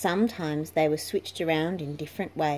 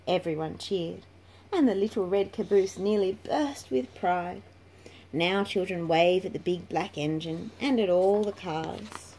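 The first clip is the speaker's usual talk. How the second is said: ordinary delivery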